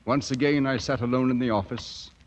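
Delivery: measured reflective voice-over; calm narrator over music; story narration. A narrator's reading. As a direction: tell it like a memory.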